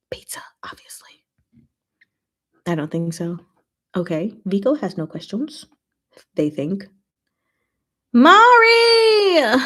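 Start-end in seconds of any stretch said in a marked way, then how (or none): 0.1-1.3 whisper. Real, not acted. Aside answer. 8.2-9.6 shout. Acted. Reading a chat greeting.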